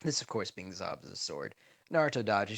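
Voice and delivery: plain reading voice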